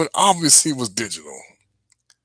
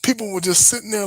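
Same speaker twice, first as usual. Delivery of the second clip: ordinary talk, nothing marked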